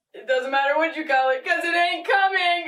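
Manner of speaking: fast, rising into a shouted punchline; embarrassing dad joke. Acted. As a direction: deliver the punchline like a proud dad. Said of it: "So it goes like".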